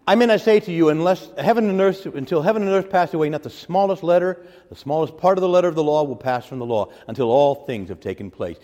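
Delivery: loud, high proclaiming of scripture; preaching at full force. Acted. Preacher voicing the Lord to his flock, loud and high. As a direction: ring it out like a prophet.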